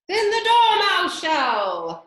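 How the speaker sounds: high, slow, loud cry together; a loud, drawn-out chorus of insistence; joint cry. A narrator's reading. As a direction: call it out as one demand. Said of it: "they both cried".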